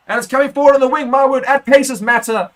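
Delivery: high, loud burst of excitement as the winger breaks; rising shout of play-by-play thrill; excited commentary. Real, not acted. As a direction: commentator yelling as the wing opens up. Winger breaks clear down the wing.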